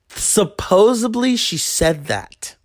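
ordinary talk, nothing marked

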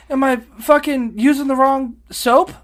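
loud, high-pitched comic bewilderment; baffled protest. Retold or imitated. Traveler guessing why the scanner flags him, baffled. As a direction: throw the question up high and loud. Acts out baffled question to screener.

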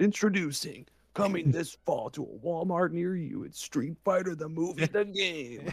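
punchy promo read for a coming release; hyped-up mock ad voice; mock trailer announcer. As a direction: sell it like a movie trailer voice-over.

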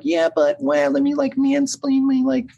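high, whiny-pitched mock Reddit commenter; put-on high voice, parodying an online know-it-all; Reddit commenter impression. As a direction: mimic a mansplaining commenter way up high. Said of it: "That's exactly what they sound like".

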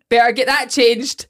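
quoting while laughing; deadpan line broken by laughter; laughing mock quip. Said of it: "As if, like".

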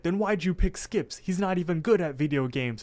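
retold angry whine of protest; mock angry. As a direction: imitate someone protesting, mildly worked up. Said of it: "Rigby gets all mad".

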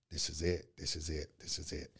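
repeated three times, sinking to a whisper; fading retold dread. Bleeding out, he mutters his fear, fading to a whisper. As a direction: let each repeat drain away until it's only breath.

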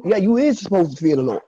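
ordinary delivery

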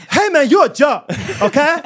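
high, loud, swaggering sass; hammed-up diss voice, laughing; mock sassy taunt. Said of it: "I, I (reacting to "you're a joke")".

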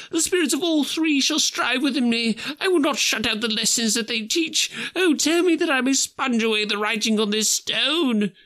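Scrooge, vowing. Acted. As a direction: swear it with all his heart. Scrooge's desperate vow.

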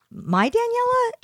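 drawn-out disbelieving question; surprised. Retold or imitated. Surprised retort, retold.

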